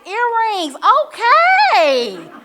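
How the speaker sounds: high, loud, laughing "earrings, okay"; playful loud approval with a laugh; sassy compliment. Retold or imitated. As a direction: hype her up with a sly, knowing two-word compliment. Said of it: "if I saw Stephanie and I was like".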